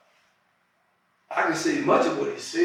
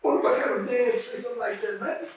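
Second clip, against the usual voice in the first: retold amazement; 7 semitones higher than usual. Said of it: "I said".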